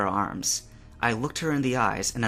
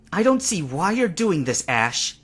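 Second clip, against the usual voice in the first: higher, louder growl in Misty's voice; 7 semitones higher, 5 dB louder than usual. A narrator's reading. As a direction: growl it at him, defiant. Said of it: "she growled".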